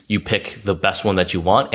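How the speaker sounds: ordinary delivery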